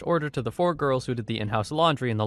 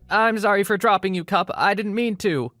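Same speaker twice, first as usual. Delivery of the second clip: high, louder pleading apology; flustered trainee; 8 semitones higher, 4 dB louder than usual. Retold or imitated. Trainee apologizing to a dropped cup, high pleading voice. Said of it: "So the trainee says".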